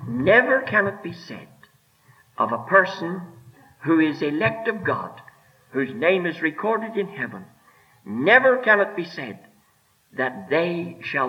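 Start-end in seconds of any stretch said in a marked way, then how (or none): 0.1-1.5 never hit higher, falling after; stress on never. Shown as a demonstration. Preacher hammering home election, stressing never. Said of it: "I emphasize the word". 8.0-9.4 never hit higher, falling after; stress on never. Shown as a demonstration. Preacher repeating his point, stressing never. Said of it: "I emphasize the word".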